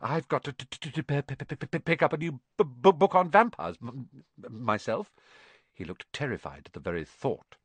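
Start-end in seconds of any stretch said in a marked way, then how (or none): 0.0-5.1 nervous titter, then high stuttering chatter; nervous stammer. A narrator's reading. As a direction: stutter on through the nerves. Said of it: "He laughed nervously".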